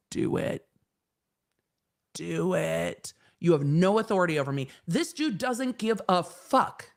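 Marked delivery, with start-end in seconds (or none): 0.1-4.7 put-on low, unimpressed dare from a kid; defiant kid. Kid against a wall daring a parent, defiant. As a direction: dare them like a kid who doesn't care. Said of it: "The kid that would sit against a wall".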